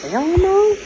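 high-pitched hell no; emphatic refusal. Retold or imitated. Answering the teasing, refusing.

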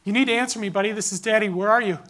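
loud, urgent calling into the woods; frantic father shouting his son's name; screaming for Jack. Retold or imitated. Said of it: "and I start screaming".

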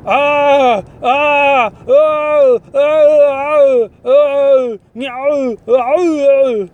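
high, loud hammed-up yelps of fake pain; mock pained cries. Faking suspect yelping at cop, loud mock pain cries. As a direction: overdo the fake agony ah sounds.

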